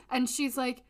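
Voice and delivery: ordinary delivery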